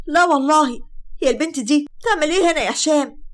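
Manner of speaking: high, loud outraged demand; angry confrontation. Acted. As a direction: confront him furiously. Bride confronts ex with fiancée.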